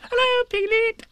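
very high, loud excited squeak; little Roo squeaking at his first party; squeaky Roo. A narrator's reading. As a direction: squeak it like a tiny joey. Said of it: "he squeaked".

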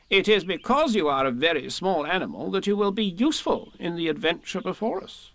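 a bossy rabbit encouraging; Rabbit's voice. A narrator's reading. Rabbit reassures Piglet.

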